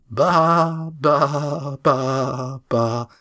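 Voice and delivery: falling mock baa baa; sheep bleating. Cam imitates sheep.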